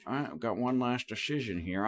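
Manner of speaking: a light, soft Owen Wilson put-on; weird Owen Wilson voice. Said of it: "I put on my weird Owen Wilson voice".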